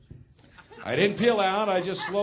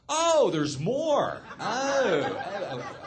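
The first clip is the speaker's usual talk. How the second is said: mock levity; much slower than usual. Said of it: "Try a little levity".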